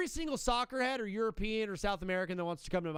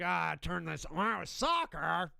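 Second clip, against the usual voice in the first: put-on slow, grumbling old-man complaint; mock dad who hates soccer; imitating a grumpy dad; much slower than usual. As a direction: drag out soccer like you're disgusted by it. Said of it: "Your dad was like".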